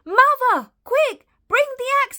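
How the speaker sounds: very high, loud call to mother; urgent shout. A narrator's reading. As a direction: yell for help in a hurry. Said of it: "he shouted".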